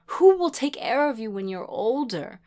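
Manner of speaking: distraught, forceful demand. A narrator's reading. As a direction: demand an answer, loud and high. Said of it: "she demanded".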